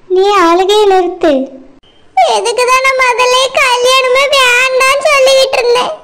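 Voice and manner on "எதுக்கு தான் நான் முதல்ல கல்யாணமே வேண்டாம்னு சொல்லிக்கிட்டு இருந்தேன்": crying reproach, voice breaking. Acted. Daughter blames parents for her marriage, crying. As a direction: blame your parents through tears. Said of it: "நீ அழாதம்மா".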